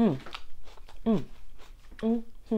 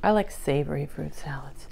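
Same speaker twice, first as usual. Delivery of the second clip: usual speaking voice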